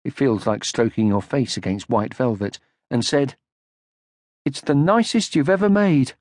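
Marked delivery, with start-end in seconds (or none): 4.4-6.1 lightly voiced young troll, higher and grateful; a high, fond little thank-you; Moomin Troll. A narrator's reading. Son thanks his mother.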